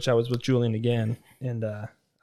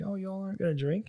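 higher, softer surprise; surprised question; 7 semitones higher, 4 dB quieter than usual. Retold or imitated. Asking the women at the rooftop bar, surprised. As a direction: ask in mild surprise. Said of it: "I was like".

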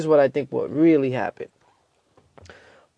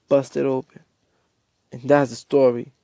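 ordinary delivery

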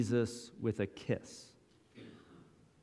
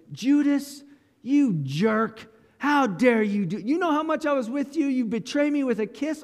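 higher, louder hurt outrage; indignant outrage; 10 semitones higher, 3 dB louder than usual. Pretend. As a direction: hurl the betrayal back at Judas. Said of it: "think if it would have looked like this".

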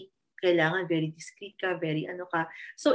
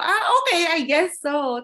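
mock concession; 9 semitones higher, 6 dB louder than usual. Conceding after Peppa Pig explanation.

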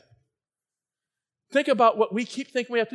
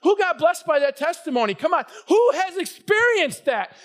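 calling out; 6 semitones higher, 3 dB louder than usual. Real, not acted. Preacher calls out to congregation.

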